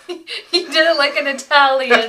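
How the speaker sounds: loud, helpless laughing tease; laughing hard. Real, not acted. Teasing friend over a botched blessing, laughing hard. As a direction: crack up loudly while teasing.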